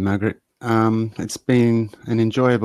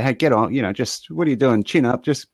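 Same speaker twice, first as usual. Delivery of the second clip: brisk, louder and higher mock chivvying; dismissive pep talk; 4 semitones higher, 4 dB louder, faster than usual. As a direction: imitate someone bossily telling a person to buck up. Mimics dismissive advice to the unwell.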